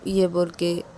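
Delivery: usual speaking voice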